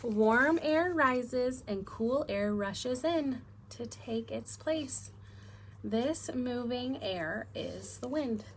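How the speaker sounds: slow, clear storybook reading; reading to children. Acted. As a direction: read it slowly to little kids. Reads picture book to class.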